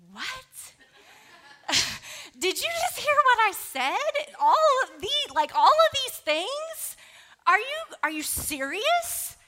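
high, loud disbelief with a laugh; incredulous. Retold or imitated. Retelling her shocked reaction to grandma's advice, high and loud incredulous. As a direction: shriek it like you can't believe your ears. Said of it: "I'm kind of, like, mad".

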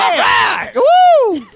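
high shouted cheer; party cheer. Acted. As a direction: whoop it up.

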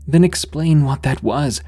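high, insistent retort; shot back. A narrator's reading. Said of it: "I shot back".

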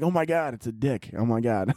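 low, stunned half-whisper; hushed shock. Retold or imitated. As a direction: breathe it out in quiet disbelief. Older self realizing, shocked.

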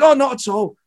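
high-pitched imitated comic brushing it off; Billy Connolly. Famous comic reassuring apologetic fans, imitated. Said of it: "He goes".

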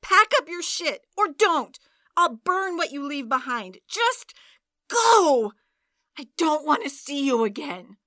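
loud, high, furious yelling; raging at her boyfriend to leave; furious shouting. Acted. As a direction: scream him out of the flat. Furious partner throwing him out.

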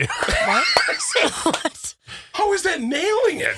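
high, loud laughing shriek of disbelief; squealing disbelief; shocked outburst. Real, not acted. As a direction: yelp it, half laughing, half outraged. Outraged at hearing-test news.

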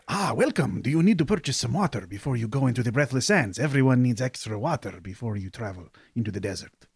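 eager merchant hustling a sale; excited greeting. Acted. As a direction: jump in brightly, keen to sell water. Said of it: "jumps up excitedly and says".

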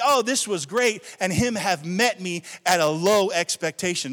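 loud, driving sermon delivery; forceful, emphatic pulpit voice; full-force preaching. Acted. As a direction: preach it out hard to the whole church.

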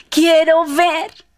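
high, loud, stretched-out cry; fervent plea. Acted. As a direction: plead loudly, drawing out each word. Blind man pleads to Jesus. (súplica aguda y alargada)

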